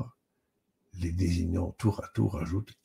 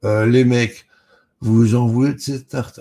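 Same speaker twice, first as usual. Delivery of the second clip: loud, higher tough-guy challenge; very masculine posture; 5 semitones higher, 11 dB louder than usual. A narrator's reading. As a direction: challenge the lads loudly, playing macho. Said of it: "en adoptant une posture très masculine". (interpellation virile, forte et haute)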